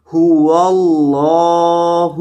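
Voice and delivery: هو اللہ with a rounded-lip ha from the throat. Shown as a demonstration. Teacher demonstrating the throat sound of ha. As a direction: round the lips on the ha of هو. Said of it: "تو اس کی آواز آئے گی".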